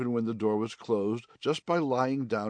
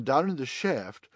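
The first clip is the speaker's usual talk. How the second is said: ordinary delivery, nothing marked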